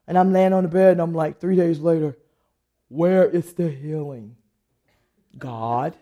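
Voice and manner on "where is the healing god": slow, low mock complaint; plaintive demand. Sick woman on the bed asking God, slow low complaint. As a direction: draw out the question in a lowered voice.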